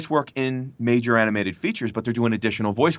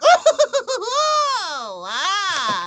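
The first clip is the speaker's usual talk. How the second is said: high, laughing minion shout; squeaky minion crowd cheer. Retold or imitated. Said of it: "You know, like that".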